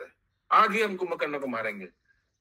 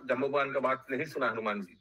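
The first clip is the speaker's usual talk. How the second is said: ordinary talk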